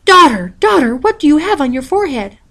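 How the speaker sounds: loud, high alarmed calling; horrified cry. A narrator's reading. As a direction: panic at the sight of the horn. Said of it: "She was horrified".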